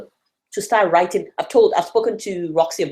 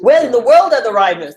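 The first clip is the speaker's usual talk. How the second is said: laughing; 3 semitones higher, 7 dB louder than usual. Real, not acted. Reads title aloud amused.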